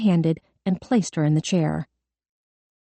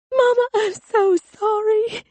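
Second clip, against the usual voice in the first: slow, very high tearful apology; wailing; slower than usual. A narrator's reading. Girl apologizes to her mother in court, wailing. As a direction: stretch the words into a girl's high wail. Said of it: "Kelly began to wail".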